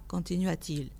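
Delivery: ordinary delivery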